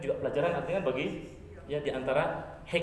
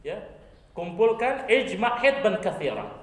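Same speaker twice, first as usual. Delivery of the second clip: loud commanding call, higher; urgent loud call to Bilal; 4 semitones higher, 6 dB louder than usual. Acted. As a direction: summon Bilal from across the crowd. Prophet calls out to Bilal.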